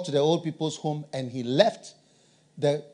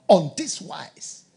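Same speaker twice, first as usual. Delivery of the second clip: ordinary talk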